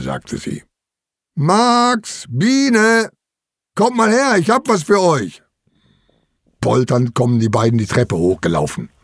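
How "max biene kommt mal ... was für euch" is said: put-on high, carrying call to the children. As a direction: call them over in a raised pitch. Uncle calls the children over.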